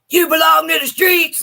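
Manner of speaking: high, loud hammed-up shout; mock shouted clip line. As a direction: yell it like a goofy sound clip. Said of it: "all of a sudden you hear".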